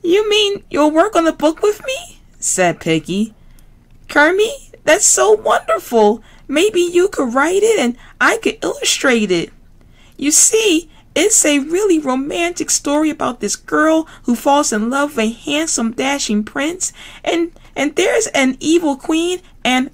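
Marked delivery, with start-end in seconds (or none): 0.0-2.2 very high squealy piggy voice; Miss Piggy voice. Acted. Miss Piggy to classmates, very high pig voice. 4.1-19.4 very high squealy piggy voice; Miss Piggy voice. Acted. Miss Piggy to classmates, very high pig voice. 19.6-19.9 higher frog trying to cut in; protesting Kermit. Acted. Kermit trying to protest to Piggy, higher. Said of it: "protested Kermit".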